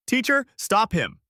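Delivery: high, loud shout; calling out. A narrator's reading. Prisoners rushing after Kang Dante, calling out. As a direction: shout urgently to be heard. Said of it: "calling out".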